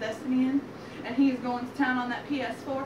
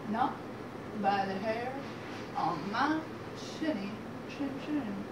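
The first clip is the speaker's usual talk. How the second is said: quiet refusal; 2 semitones lower, 7 dB quieter than usual. Acted. Pig refuses wolf through cracked door.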